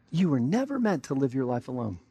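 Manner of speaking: ordinary talk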